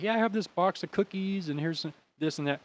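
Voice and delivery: high put-on lady's voice; kindly old lady. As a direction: imitate an eager old lady offering treats. Imitating second old lady offering snacks.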